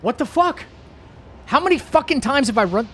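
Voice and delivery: sudden high, loud yell; furious outburst. Real, not acted. Streamer failing the same jump, high loud yell. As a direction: explode at the screen. Raging at failed run.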